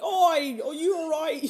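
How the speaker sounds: high, loud Cockney Oi with a laugh; Cockney man. Retold or imitated. Cockney man at the airport checking on the fainted passenger, high and loud Cockney. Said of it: "There was this beautiful Cockney man".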